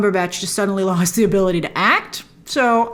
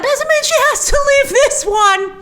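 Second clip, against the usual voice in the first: mock crying. Dreads Zendaya leaving the franchise.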